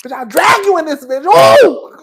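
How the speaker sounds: high mock-angry yell ending in a whoop; hammed-up fighting holler; Candy pissed off. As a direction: imitate a fired-up threat, loud and high. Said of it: "I said Candy was pissed off".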